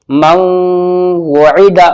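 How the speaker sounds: very slow chanted मौइदा; recitation. Shown as a demonstration. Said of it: "Quran recitation demo".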